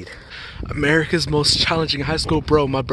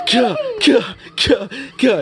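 shouted caw; 12 semitones higher, 3 dB louder, slower than usual. Pretend. High shouted mock cry.

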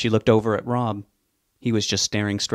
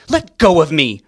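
high, much louder screech; 6 semitones higher, 10 dB louder than usual. Acted. Wife screeches at husband gripping her arm. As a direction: shriek as you pull free. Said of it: "Veronica screeched at him".

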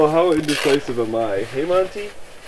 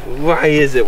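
ordinary delivery

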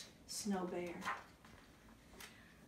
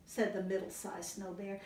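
ordinary delivery

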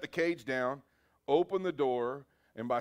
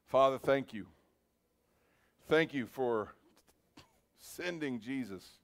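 closing prayer. Acted. Pastor leads bowed congregation in thanks, earnest prayer. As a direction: pray it from the heart, gently leading the room.

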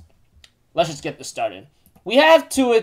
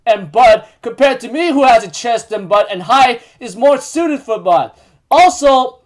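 high, loud retold rant; indignant tirade; 6 semitones higher, 6 dB louder than usual. Jericho tells off Elaine over loving Bond, indignant. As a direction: act out a heated, boastful telling-off.

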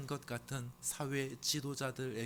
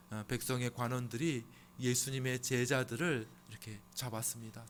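usual speaking voice

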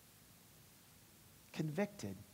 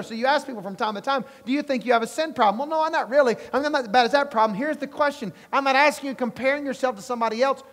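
loud, high, driving sermon delivery; full-force preaching; 9 semitones higher, 7 dB louder than usual. Acted. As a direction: preach it hard to the congregation.

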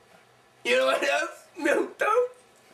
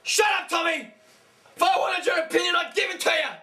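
higher, yelling in a rage; furious shout; 4 semitones higher than usual. Acted. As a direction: scream at the crew. Santa screaming at crew.

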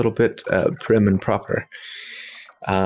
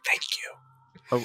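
whispered thanks; 3 semitones higher, 11 dB quieter than usual. Acted. Winks at Zappy, whispers thanks.

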